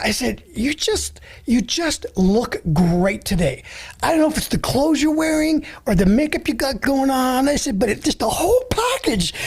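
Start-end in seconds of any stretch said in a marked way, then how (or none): 0.6-7.5 gushing compliment, drawn out; retold effusive flattery, swooping pitch; very expressive. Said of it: "with so much expression". 7.8-9.3 high, gushing compliment; effusive, hammed-up praise; very expressive. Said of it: "I was talking like that".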